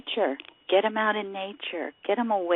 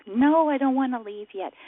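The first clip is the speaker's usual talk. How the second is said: higher-pitched mimicked child's plea; retold child's protest in a raised voice; reluctant daughter; 7 semitones higher than usual. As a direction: lightly imitate a child begging to stay. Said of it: "then she wouldn't wanna leave nature".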